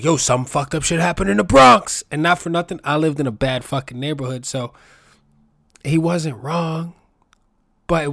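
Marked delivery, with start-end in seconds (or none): 0.0-2.0 loud, high mocking call of the bad news; mock news shout. Friend retelling Bronx news headlines, loud mocking shout. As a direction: blurt it out like gossip from the block. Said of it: "it would always be like".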